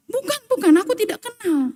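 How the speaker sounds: high, hurried mock panic of a cornered man; hammed-up frantic denial; panicked denial. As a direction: deny it in a rush, voice jumping up. Peter's frantic denial acted out.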